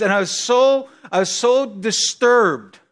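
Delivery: ordinary talk, nothing marked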